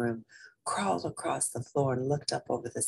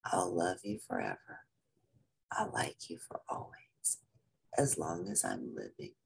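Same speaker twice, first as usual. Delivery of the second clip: much softer, half-whispered lullaby; tender hushed song; 10 semitones lower, 9 dB quieter than usual. A narrator's reading. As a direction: sing it barely above a whisper, tenderly. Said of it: "And he sang this song".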